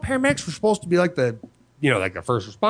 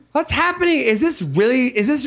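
put-on bewildered girl; 8 semitones higher than usual. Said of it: "she was just like".

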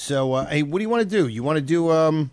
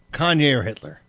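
ordinary talk

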